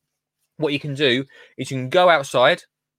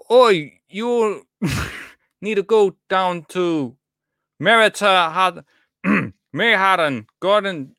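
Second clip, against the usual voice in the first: overdone mock-Scottish, pitched up; terrible Scottish accent; 6 semitones higher than usual. Shown as a demonstration. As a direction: ham up a bad Scottish accent, stumbling over the vowels. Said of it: "try your best Scottish accent".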